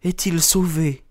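high-pitched woman's character voice; narrated anxious female exclamation; anxious cry. A narrator's reading. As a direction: cry out high, without added volume. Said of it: "s'écria-t-elle".

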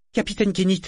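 voix de garçon aiguë, suppliante; garçon désespéré. A narrator's reading. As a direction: appeler le capitaine avec détresse. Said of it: "sur un ton désespéré". (le mousse appelle son capitaine, voix aiguë et désespérée)

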